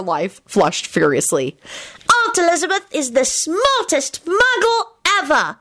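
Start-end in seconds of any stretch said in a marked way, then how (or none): 2.3-5.6 put-on high-pitched child's shriek of joy; high, excited cheer without much extra volume. A narrator's reading. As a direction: proclaim it high, thrilled. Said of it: "Teddy shrieked".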